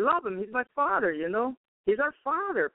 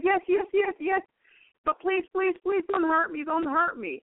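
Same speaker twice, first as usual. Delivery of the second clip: frightened begging; 7 semitones higher than usual. Retold or imitated. Demon begs not to be hurt.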